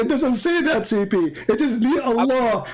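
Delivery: a mild mock yell, mimicking the caller; mock shouting opponent. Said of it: "you were shouting at me, saying".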